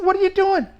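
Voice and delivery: high, loud mock disbelief; astonished what are you doing; incredulous retort. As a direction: act caught off guard, voice jumping high. Repeating the surprised question.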